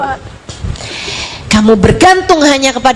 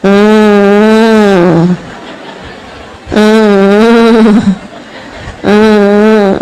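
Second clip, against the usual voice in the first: mock child whimper; 6 semitones lower, much slower than usual. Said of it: "nangisnya kayak gitu tuh".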